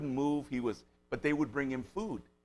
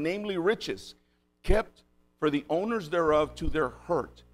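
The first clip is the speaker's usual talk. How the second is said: slow, raised preaching read of scripture; 2 semitones higher, 4 dB louder, slower than usual. Acted. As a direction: read the verse solemnly and deliberately. Reads scripture verse aloud.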